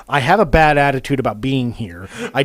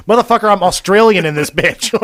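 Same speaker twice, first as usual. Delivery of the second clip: playful louder boast; 6 semitones higher, 9 dB louder than usual. Retold or imitated.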